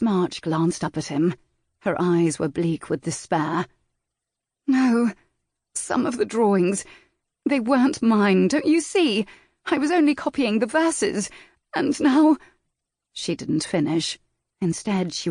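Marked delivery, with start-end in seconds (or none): 4.7-12.4 put-on high, anguished young woman, breaking off; lightly voiced woman pleading; despairing young lady. A narrator's reading. Miss March voiced, despairing.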